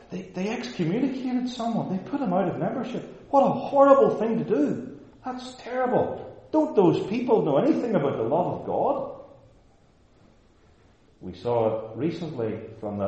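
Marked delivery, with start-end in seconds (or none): 0.1-9.2 high-pitched horrified objector; hammed-up outrage, far above usual pitch; mock scandalized gossip. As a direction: squeal in put-on moral shock. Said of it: "you yourself will have heard this".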